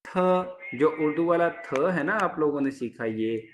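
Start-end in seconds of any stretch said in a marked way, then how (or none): 0.1-0.1 थ with a puff of breath; aspirated t. Shown as a demonstration. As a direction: say थ, dental and breathy. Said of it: "TH वाली sound देगा".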